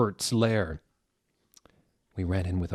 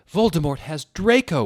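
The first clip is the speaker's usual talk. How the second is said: higher, louder urgent shout; 8 semitones higher, 8 dB louder than usual. A narrator's reading. Ebony and Vampire shouting the news to Dumbledore. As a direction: blurt it out together in alarm. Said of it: "we shouted at the same time".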